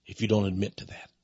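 hushed, voiceless aside; a whispered threat to the listeners; whispered warning. Real, not acted. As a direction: drop to a whisper to make it ominous.

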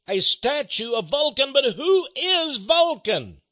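rising pulpit fervour, rolling toward the reveal; preaching build-up. Acted. Radio preacher winds up listeners about the statue, in fervent preaching.

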